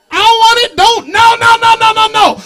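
flat-footed, no holding back; fiery preaching rant. Retold or imitated. Retelling his rant to church.

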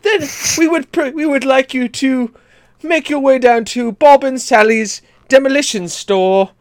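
high posh British announcer; hammy British ad read; British accent. Pretend. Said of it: "what is a British monster truck ad like".

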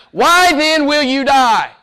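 slow, high, loud pleading cry; thundering God's plea from the pulpit; preached loud. Acted. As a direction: call it out over the crowd, slowly. Preached quote, then repeated softly.

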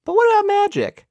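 high, squeaky mock-naive voice; mock dim questioner. Podcaster mocking characters who forgot about magic, high mock-naive voice. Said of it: "anyone was like".

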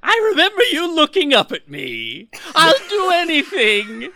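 high, loud maniacal villain impression; crazed Joker, loud, high and drawn out; Cesar Romero Joker. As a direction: stretch it out in a manic, high-pitched cackling voice. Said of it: "in the Cesar Romero voice".

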